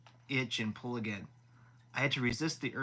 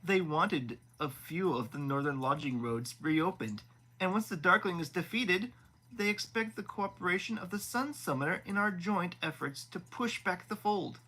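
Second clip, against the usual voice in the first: higher, smug and self-satisfied prince; 7 semitones higher than usual. Acted. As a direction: preen while laying out the terms. Vasily explaining the deal.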